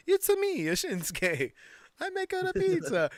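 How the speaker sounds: high, loud cartoon Italian accent. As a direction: ham up an Italian chef voice. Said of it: "an Italian accent".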